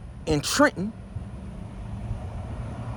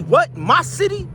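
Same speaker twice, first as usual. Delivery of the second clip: mocking challenge; 10 semitones higher than usual. Real, not acted. Taunting a rival over his city.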